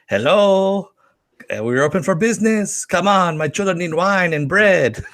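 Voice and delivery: high-pitched, hammed-up French accent; bad French accent. Euro Disney begging for business, bad French accent. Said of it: "my bad French accent aside".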